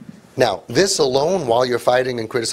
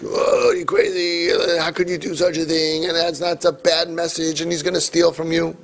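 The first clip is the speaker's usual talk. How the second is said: high-pitched mock outrage; hammed-up scolding therapist, pitched high; angry therapist; 4 semitones higher than usual. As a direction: wail it like an indignant therapist. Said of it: "the angry therapist".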